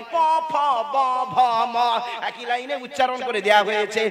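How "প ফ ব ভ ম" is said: প, ফ, ব said very slowly, one by one; letters spelled out. Shown as a demonstration. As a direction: sound out each lip letter slowly. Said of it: "দুই ঠোঁট থেকে উচ্চারণ হয়".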